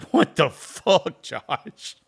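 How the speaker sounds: high, loud, slow outraged yell; mock angry dad. Dad on the phone yelling at his son Josh, mock angry yell. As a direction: bellow it like a furious freaked-out dad.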